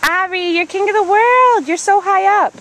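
high and bright calling up; excited. Real, not acted. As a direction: cheer him on from below. Calling up to child on climber.

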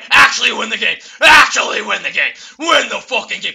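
loud shouted disbelief; real victory yell; ecstatic shout. Real, not acted. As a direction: yell the win out. Winning the game.

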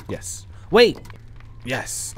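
normal talking voice

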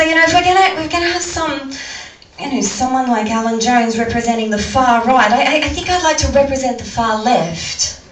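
ordinary talk

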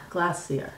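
glacier as "GLASS-ee-er". Shown as a demonstration. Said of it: "said it with an a".

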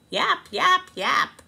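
high, loud little yaps; yapping dog. Acted. The neighbour's dog chasing the cat, high yapping. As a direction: bark like a small dog.

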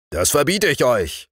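stern telling-off; stern forester. Acted. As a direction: forbid it with firm authority. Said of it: "sagte der Förster streng".

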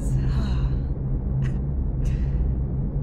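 long low breathy sigh. Acted. [name] ending her radio report with a long weary sigh. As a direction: let the air out, tired of it all.

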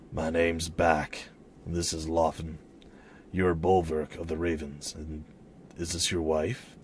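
low, subdued man's voice; hooded stranger. Acted. Hooded man introducing himself to the Ravens, low stranger's voice. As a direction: speak low as a reserved traveler.

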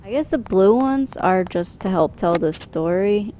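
normal talking voice

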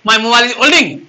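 the mistaken form, said as a plain example; wrong version. Shown as a demonstration. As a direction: say the wrong sentence with olding, matter-of-fact. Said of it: "कहेगा, कथी बोल रहा है भाई". (गलत वाक्य, सामान्य लहजे में)

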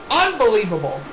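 low, emphatic commentator's disbelief. Pretend. Repeats it emphatically.

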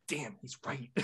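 put-on mumbled teen aside. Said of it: "He acts like a teenager where he's like".

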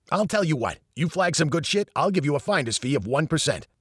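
put-on brash New York accent; a fast-talking New York accent, lightly voiced. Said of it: "Eddie spoke with a strong New York accent".

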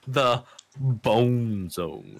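long, drawn-out deep announcement; really deep voice. Pretend. Goofing to friends about the store name, really deep voice. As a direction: draw it out slowly, deep and booming. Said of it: "do it in a really deep voice".

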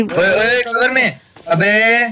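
high urgent shout; cover me shout. Real, not acted. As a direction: shout at him to get in cover. Urgent call to take cover.

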